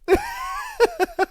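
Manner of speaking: a squeaky high burst of laughter; high wheezing laugh. Real, not acted. Streamer reading chat, high squeaky laugh.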